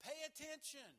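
loud, high call to attention; hey, listen up, pay attention. Retold or imitated. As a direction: shout it like waking a crowd. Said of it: "That means".

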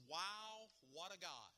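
bright, lifted exclamation of praise; enthusiastic. Shown as a demonstration. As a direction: say it like you're amazed at God. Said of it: "And you say that with, with enthusiasm".

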